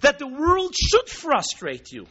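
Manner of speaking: high, loud, driving sermon voice; fervent preaching. Acted. Rabbi preaching to his listeners, loud and fervent. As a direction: preach it out to the crowd with fire.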